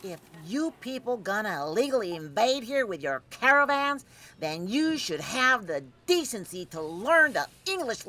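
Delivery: high-pitched haughty rant; high indignant lecture; indignant scolding. Acted. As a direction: berate strangers with outrage. Bigoted stranger berates girls.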